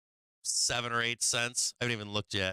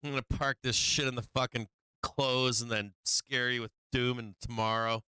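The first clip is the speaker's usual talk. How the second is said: usual speaking voice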